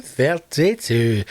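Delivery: "Thirty-two" stretched slowly; drawn-out number. Pretend. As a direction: savour "Thirty-two", dragging out each syllable. Savouring the episode number.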